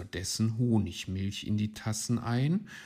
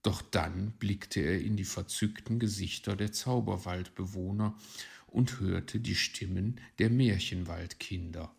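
ordinary delivery

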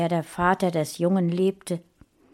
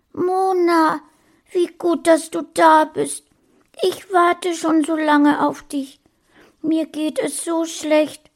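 put-on high, loud, plaintive little boy; weeping boy greeting Mona with relief; tearful child; 11 semitones higher, 6 dB louder than usual. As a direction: cry out with relief and misery. Crying boy greets his rescuer.

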